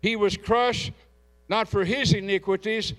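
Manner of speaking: preached scripture reading. Acted. Pastor reading Isaiah aloud to the congregation, declaiming. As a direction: proclaim the verses over the church.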